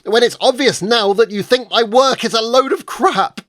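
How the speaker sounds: loud, high, ranting fury; furious shout. Acted. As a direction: let the whole grievance explode. Angry outburst at his agent.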